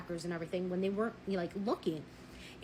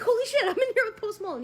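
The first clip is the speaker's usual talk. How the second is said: high, loud starstruck gasp; a patient spotting a lookalike, thrilled and loud; starstruck excitement; 13 semitones higher, 7 dB louder than usual. Retold or imitated. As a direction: blurt it out, amazed to spot a celebrity. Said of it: "I'm like".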